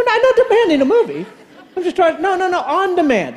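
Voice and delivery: high, laughing protest; laughing exasperation. Retold or imitated.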